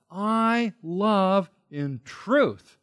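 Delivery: very slow, I held high; emphatic I. Shown as a demonstration. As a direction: stretch I out, then space "love in truth". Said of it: "He's being emphatic".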